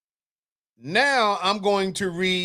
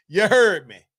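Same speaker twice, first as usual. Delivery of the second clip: louder New Orleans "You heard me." with "heard" stressed and falling; New Orleans phrase; 3 semitones lower, 4 dB louder than usual. Retold or imitated. Host granting a pass for the phrase, in a New Orleans accent. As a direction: drop "heard" down at the end, New Orleans style. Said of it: "just on saying".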